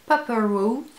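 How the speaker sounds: lightly put-on American accent on the product name. Shown as a demonstration. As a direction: say Pepper Rose the American way, half playful. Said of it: "J'aime bien mettre l'accent américain". (nom du produit avec un léger accent américain)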